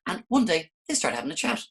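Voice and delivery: ordinary delivery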